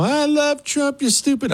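very high-pitched mocking fan voice; mock angry emailer. Host imitates a listener emailing him, mock high-pitched outrage. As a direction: squeal it in a silly high caricature. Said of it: "you're writing me an email".

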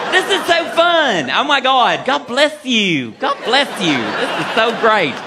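gushing Southern belle, drawn-out vowels; hammed-up Southern hostess; Georgia peach lilt. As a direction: lay on the Georgia drawl, lilting up and down. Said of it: "got that Georgia peach lilt to it".

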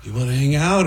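nervous. Retold or imitated. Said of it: "But I was so nervous".

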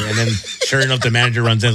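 normal talking voice

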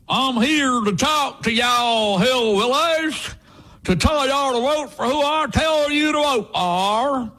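slow, high, hammed-up hillbilly drawl; Trump faking a hillbilly accent. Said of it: "Can you imagine if Trump went to Kentucky".

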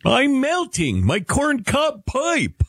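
very high, slow cartoon wail; hammed-up, drawn-out snowman voice; Frosty the Snowman. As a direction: cry out like a melting cartoon snowman. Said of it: "he sounds like Frosty the Snowman".

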